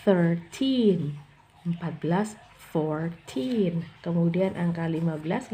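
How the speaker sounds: put-on thirteen to eighteen with teen drawn long and stressed. Shown as a demonstration. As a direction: lean on the teen ending. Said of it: "bacanya panjang di bagian belakang".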